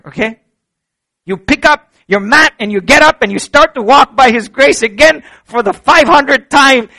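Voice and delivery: loud, high, driving sermon climax; rising fervent pulpit voice; full-force preaching. Acted. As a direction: preach it out to the whole room.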